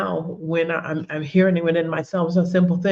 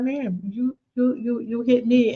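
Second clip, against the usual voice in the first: you can't believe she hit you; stunned, slowed complaint; incredulous protest; 5 semitones higher, slower than usual. Retold or imitated. Confronting the other driver.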